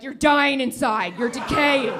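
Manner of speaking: doctor's blunt verdict. Retold or imitated. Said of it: "they're like".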